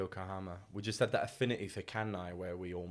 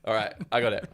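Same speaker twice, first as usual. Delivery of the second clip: ordinary delivery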